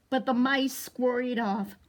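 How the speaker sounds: picture book read to toddlers; storytime reading. A narrator's reading. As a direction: read it slowly and big for the kids. Reads the picture book aloud.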